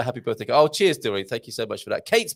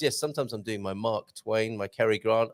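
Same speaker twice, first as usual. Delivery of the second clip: normal talking voice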